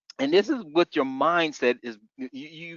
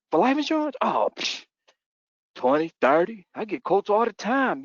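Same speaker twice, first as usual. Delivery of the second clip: mock customer; 9 semitones higher, 3 dB louder than usual. Customer scoffing about quotes.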